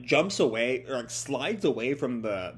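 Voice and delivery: normal talking voice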